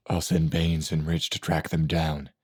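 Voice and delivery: ordinary delivery, nothing marked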